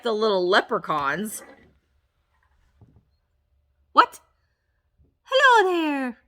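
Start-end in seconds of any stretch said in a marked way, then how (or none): 3.9-6.2 put-on failed Irish accent. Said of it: "And I can't do an Irish accent".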